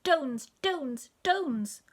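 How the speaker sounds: "Dones" with D for J, high; high baby-talk "Dones", repeated; baby mispronunciation. A narrator's reading. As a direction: pipe "Dones" like a toddler who can't say J. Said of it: "she couldn't quite say Jones".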